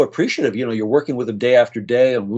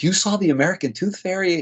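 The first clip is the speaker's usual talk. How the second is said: retold amazed question, pitched higher; 8 semitones higher than usual. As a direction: echo the boy's wonder, high and hushed. Boy amazed by tooth fairy gift.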